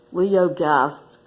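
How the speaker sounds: ordinary delivery, nothing marked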